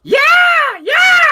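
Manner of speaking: very high, loud whooping yell; rebel yell. Retold or imitated. Demonstrating the rebel yells heard in the speech, high shout. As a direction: let out a piercing battle yell. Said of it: "That's the rebel yell".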